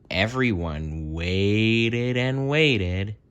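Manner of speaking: slow, drawn-out waiting; slow suspense. A narrator's reading. Reader drawing out the suspense of the wait, slow. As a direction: stretch the wait out.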